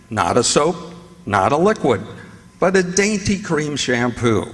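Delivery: ordinary delivery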